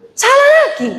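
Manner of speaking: high, loud cry of salah lagi; exclaimed punchline. Real, not acted. (seruan tinggi dan keras)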